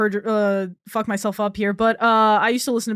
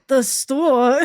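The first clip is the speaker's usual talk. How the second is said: louder mocking repeat of store; mocking echo; 2 semitones higher, 5 dB louder than usual. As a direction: echo the word back, mocking the accent. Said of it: "Bill Burr's like".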